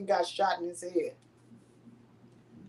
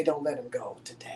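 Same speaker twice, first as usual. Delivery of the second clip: soft pleading whisper; whispered prayer; 7 semitones lower, 9 dB quieter than usual. Retold or imitated. Mother at her son's bedside, whispering a prayer. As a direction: breathe the plea with no voice. Praying over her son in hospital.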